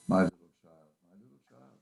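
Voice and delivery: a father's grief slipping out, fading to nothing; breaking down. Acted. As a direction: voice crumbling into a hush. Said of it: "He broke down all at once".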